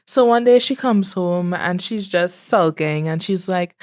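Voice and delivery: ordinary talk, nothing marked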